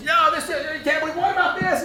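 high, laughing mock protest; imitating a student firing objections; mock debater.

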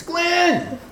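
high-pitched name call; excited phone greeting. Retold or imitated. Professor phoning the preacher, excited. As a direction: sing out the name eagerly.

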